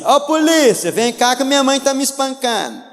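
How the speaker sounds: put-on child phoning the police on his mother; mock child calling police. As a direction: play the spoiled son tattling. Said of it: "falando assim".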